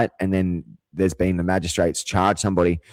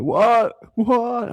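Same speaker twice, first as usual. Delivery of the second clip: squeaky, much higher panicked niece; confused niece. Retold or imitated. Niece hearing the fire alarm, squeaky high confusion. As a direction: play the startled kid who can't follow. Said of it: "And she's like".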